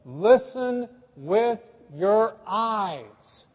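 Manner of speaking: very slow, each word spaced; wife tells husband to look at her; slow deliberate. Retold or imitated. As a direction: spell it out slowly. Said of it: "And she said".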